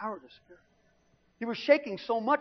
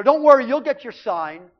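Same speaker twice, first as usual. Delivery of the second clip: loud teasing promise; 5 semitones higher, 9 dB louder than usual. Retold or imitated. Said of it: "He says to my mother".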